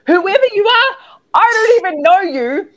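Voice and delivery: high, squealing playful protest; mock outburst. Coach addressing a viewer she can't see, high comic outburst. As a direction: squeal it at a stranger, hammed up.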